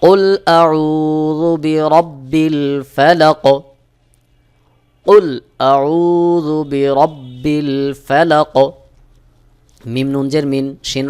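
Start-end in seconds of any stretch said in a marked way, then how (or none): 0.0-8.7 slow, drawn-out tajweed chanting; slow Quran recitation. Shown as a demonstration. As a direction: recite slowly with qalqalah. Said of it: "এটাকেই বলা হয় কলকলা". (ধীরে তাজবীদসহ তিলাওয়াত)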